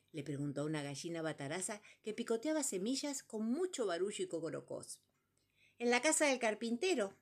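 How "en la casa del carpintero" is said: louder, higher irritated hen answer; annoyed hen. Acted. Hen answering number three, annoyed at interruption. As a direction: snap it out, bothered mid-meal. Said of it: "contestó ella fastidiada".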